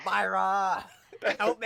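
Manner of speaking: high, loud imitated cry for help; mimicking a shouted plea; mock shouting. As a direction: call across a field, hammed up. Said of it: "like, shouting across the field".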